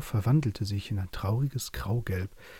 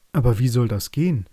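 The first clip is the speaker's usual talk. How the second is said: loud, doubting raven; the raven doubts the plan, louder; raven asking; 3 semitones higher, 8 dB louder than usual. A narrator's reading. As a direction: challenge it like a worried raven. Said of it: "fragte der Rabe".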